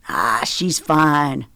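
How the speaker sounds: slow, dismissive fatherly protest; mild protest. A narrator's reading. Daddy brushing off the fuss, mild protest. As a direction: wave the worry away, slowly. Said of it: "Daddy protested".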